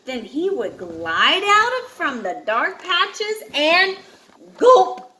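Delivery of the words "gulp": sudden loud, high gulp; the big fish strikes in one loud gulp. Acted. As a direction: snap it out like a jaws closing. Monster swallowing in story.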